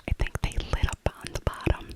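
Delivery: ordinary talk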